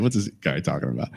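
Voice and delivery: low, muttered skeptical voice; audience member's thought. Retold or imitated. Voicing a doubtful listener, low and skeptical. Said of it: "She's shaking her head".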